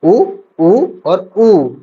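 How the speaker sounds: उ short, then long, then very long; three lengths of उ, the last held out; vowel lengthened stepwise. Shown as a demonstration. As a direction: stretch उ further on each repeat. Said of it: "ह्रस्व, दीर्घ".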